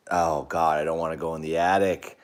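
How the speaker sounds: usual speaking voice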